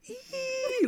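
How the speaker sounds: tiny high-pitched squeal of glee; excited squeal. Retold or imitated. Imitating his inner self buying the bike, high squeal. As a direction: squeal like a thrilled kid inside.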